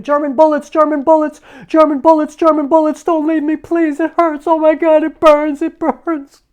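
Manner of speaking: high, loud agonized screams; screaming. Retold or imitated. Father reliving his war wounds in a dream, screaming. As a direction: scream it out in terror and pain. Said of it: "he started screaming".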